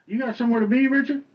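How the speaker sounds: loud, high teasing call; mock-accusing loud tease; needling jab. Real, not acted. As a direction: poke at him loudly. Teasing friend who wants to leave.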